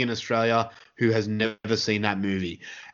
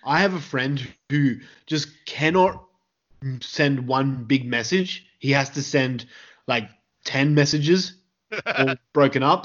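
ordinary delivery

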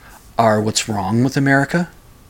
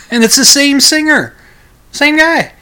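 emphatic insistence; 6 dB louder than usual. Real, not acted. Insists it is the same singer.

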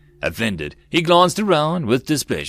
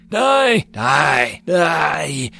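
high, drawn-out screamed curse; shrill, crazed cry of rage; mad shrilling; 6 semitones higher, 4 dB louder, much slower than usual. A narrator's reading. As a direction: shriek it madly, stretching each word. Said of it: "he shrilled madly".

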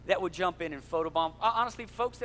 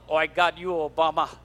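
put-on Russian accent; 3 semitones lower, 6 dB louder than usual. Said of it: "That was my worst Russian accent".

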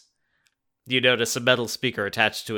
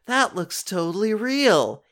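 excited; 10 semitones higher, 3 dB louder than usual. A narrator's reading. Jordan excited.

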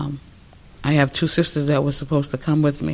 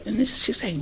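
usual speaking voice

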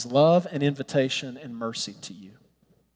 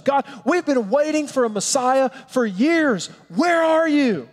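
high, desperate cry to God; imagined Nathanael crying out to God, pleading; pleading prayer; 9 semitones higher than usual. Retold or imitated. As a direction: beg God to show himself. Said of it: "Perhaps he begged God to show himself".

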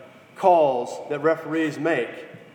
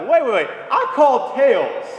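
protest; 8 semitones higher, 6 dB louder than usual. Retold or imitated. Player arguing the coin call with the referee, loudly insistent. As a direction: plead your case to the ref. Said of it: "Jerome Bettis is like".

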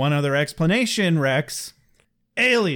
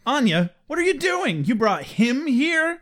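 mock Xander; 10 semitones higher than usual. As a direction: stretch out the outrage. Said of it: "he's like".

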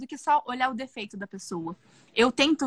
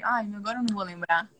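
ordinary talk, nothing marked

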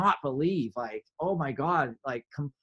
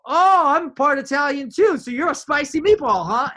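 much higher, louder teasing flirt; playful flirt; 12 semitones higher, 9 dB louder than usual. Retold or imitated. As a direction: toss out the joke, cheeky and bright. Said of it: "And I go".

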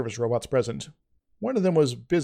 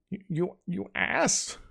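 slow, higher, softer tearful growl; growled while sobbing; 6 semitones higher, 4 dB quieter, slower than usual. A narrator's reading. Avok curled up crying, softer tearful growl. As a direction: choke out the insult through tears. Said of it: "he growled".

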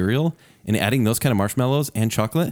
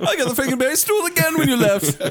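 mock whiny kid; 13 semitones higher than usual. Continuing the mocked child voice.

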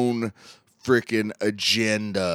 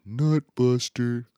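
higher, drawn-out mock heckle; imitating brother's dad; 6 semitones higher, 4 dB louder, slower than usual. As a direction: stretch it out like a dad calling at the TV. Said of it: "He said it like that".

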